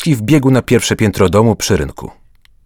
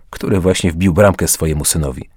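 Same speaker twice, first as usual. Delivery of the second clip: ordinary delivery, nothing marked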